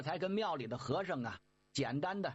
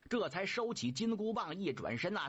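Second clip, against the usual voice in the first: ordinary delivery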